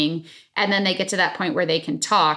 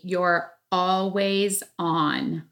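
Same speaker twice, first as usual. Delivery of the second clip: very slow, drawn-out phrase; said slowly; much slower than usual. Shown as a demonstration. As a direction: stretch every word out deliberately. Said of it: "I said that slow for a reason".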